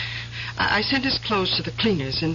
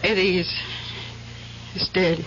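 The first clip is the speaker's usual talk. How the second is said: low, halting, grief-struck news; grave; 4 semitones lower than usual. Acted. Woman breaking news of a death to Bill, grave.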